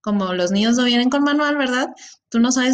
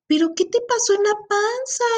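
put-on much higher, small-child voice; little child's question; 10 semitones higher than usual. Younger niece asking about the big belly, high childlike voice. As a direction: ask it like a curious three-year-old. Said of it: "me dice".

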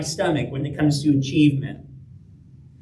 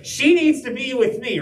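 mildly angry, higher-pitched insistence; Martha; 6 semitones higher than usual. Retold or imitated. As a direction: insist crossly on getting your way. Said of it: "Martha sounds kinda angry".

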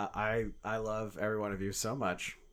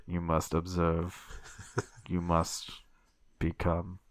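low, slow sinister command; serial killer voice; 3 semitones lower, 3 dB louder, slower than usual. Acted. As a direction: intone it like a cult killer. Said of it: "I will not do that voice again".

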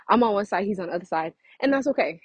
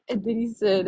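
ordinary talk, nothing marked

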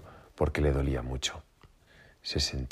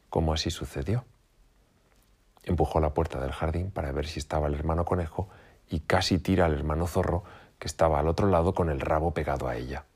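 usual speaking voice

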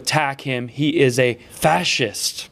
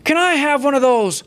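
high, clueless mock order in broken Spanish; mocking Joe Biden; 8 semitones higher than usual. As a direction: play the doddering old man ordering at Taco Bell, pitched up. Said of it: "if he went to Taco Bell and was like".

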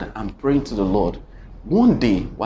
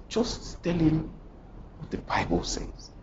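hushed divine reply; 4 semitones higher, 10 dB quieter than usual. Retold or imitated. Voice of God answering softly.

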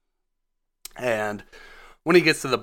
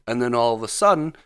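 normal talking voice

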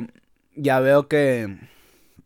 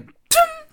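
much higher sharp mock punch noise; punch sound effect; 4 dB louder than usual. Narrator imitating the punch he threw, high cartoon punch noise. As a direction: pop it out like a cartoon hit.

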